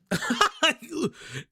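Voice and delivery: high-pitched burst of laughter; amused laugh. Real, not acted. Streamer amused by complaints about playback speed, high laugh.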